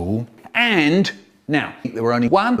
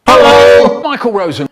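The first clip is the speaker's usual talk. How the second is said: sudden loud hello, pitch up on Michael; hammed-up loud greeting from dad; 5 semitones higher, 12 dB louder than usual. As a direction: call out the name in a big voice. Dad greets him in story.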